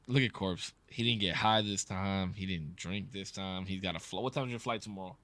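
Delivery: ordinary talk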